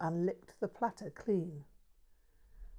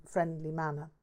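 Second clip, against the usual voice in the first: ordinary delivery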